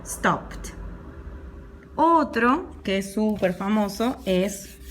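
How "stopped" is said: stopped as "stopt"; stopped in one syllable, closing on "pt"; final T sound. Shown as a demonstration. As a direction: release the T at the end of stopped. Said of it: "voy a pronunciarlo".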